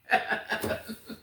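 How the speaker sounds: short run of chuckles; amused laugh. Real, not acted. Reader laughing after "did y'all get that", amused chuckle. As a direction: laugh it off for a moment.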